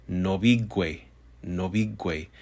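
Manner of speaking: "nobigwe" ending in g-w, like "gway"; GW suffix. Shown as a demonstration. Teacher models the word, "nobigwe" with gw ending. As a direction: finish "nobigwe" with a hard g before w. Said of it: "this suffix is pronounced gwe".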